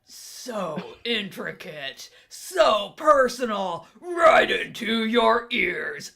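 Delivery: slow, loud, booming wrestling promo; wrestler voice. Pretend. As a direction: bellow it like a ring announcer. Hammed-up promo voice on the microphone.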